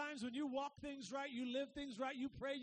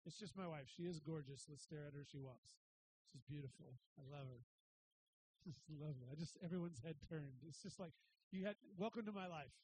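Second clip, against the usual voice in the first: lower, softer aside to the crowd; 9 semitones lower, 5 dB quieter than usual. Real, not acted. Pastor remarks on his wife walking past, softer aside. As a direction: murmur it off to the side, amused.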